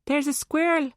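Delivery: put-on high-pitched little-girl voice; child. A narrator's reading. Daughter telling busy mum what she sees, childlike. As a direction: voice a child pointing something out. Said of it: "Rachel went on".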